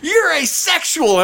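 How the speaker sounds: high, drawn-out mock accusation; heckler's outburst. Heckler jumps up to accuse the host, high mock outburst. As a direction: imitate a heckler crowing from his seat. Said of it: "he jumped out of his seat again".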